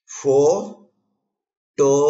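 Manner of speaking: very slow, each syllable apart with long pauses; slow syllable demo. Shown as a demonstration. Teacher showing learners the four syllables, slowly syllable by syllable. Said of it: "अब धीरे धीरे बोलता हूँ".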